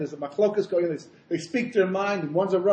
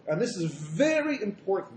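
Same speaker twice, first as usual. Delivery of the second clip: ordinary talk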